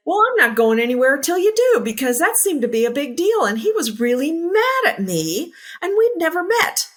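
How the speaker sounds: a student standing her ground, high and loud; insistent. Retold or imitated. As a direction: refuse to budge, voice climbing. Insisting to mentor, retold.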